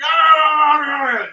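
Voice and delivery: high, loud drawn-out wordless yell; imitated yell. Storyteller imitates an angry man yelling at him, hollering. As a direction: mimic a man hollering at you. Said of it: "he starts yelling at me".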